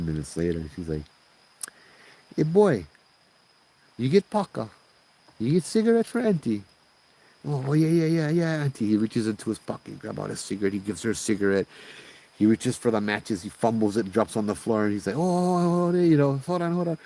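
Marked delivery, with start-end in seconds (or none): 2.4-6.6 high, drawn-up auntie questions; old Hawaiian auntie. Acted. Old lady asking scared boy for a cigarette, high auntie voice. Auntie breaks silence with request. 7.5-8.9 high, flustered agreeing; mock nervous eagerness, repeated yeses; nervous compliance. Scared boy rushing to oblige auntie, high and nervous. 15.2-17.0 high, mock-panicked stammer; flustered. Nervous boy dropping matches before auntie, high and flustered.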